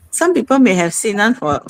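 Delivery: ordinary talk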